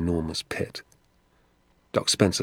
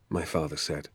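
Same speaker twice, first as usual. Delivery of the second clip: ordinary delivery, nothing marked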